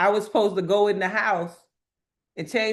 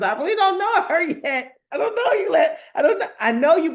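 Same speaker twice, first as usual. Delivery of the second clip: high, giggly, louder rush of words; laughing protest; 9 semitones higher, 5 dB louder than usual. Real, not acted. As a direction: protest through a rising laugh. Laughs about holding back her tears.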